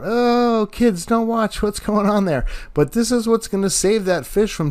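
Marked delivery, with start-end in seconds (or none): 0.0-2.4 higher, mock-horrified tourist mom; shocked tourist. Tourist on the beach sees him bleeding fish, mock-horrified. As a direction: lightly play a shocked tourist shielding her kids. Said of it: "tourists can be freaking out".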